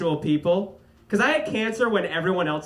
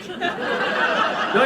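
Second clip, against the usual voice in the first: carnival barker; 9 semitones higher, 3 dB louder than usual. Retold or imitated. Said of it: "make that into a carnival game".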